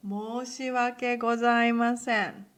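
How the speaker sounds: loud, deeply formal apology. Shown as a demonstration. Teacher showing the most formal sorry, loud and formal. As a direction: declare the apology with full ceremony.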